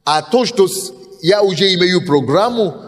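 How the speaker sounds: ordinary talk, nothing marked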